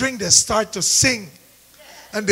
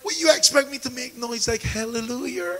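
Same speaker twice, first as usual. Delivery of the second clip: usual speaking voice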